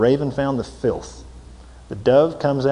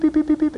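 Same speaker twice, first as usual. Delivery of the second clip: beeping sound. Retold or imitated. Flesh beeping like alarm.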